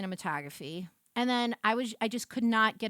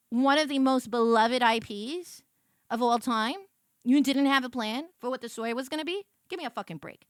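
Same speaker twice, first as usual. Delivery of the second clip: ordinary talk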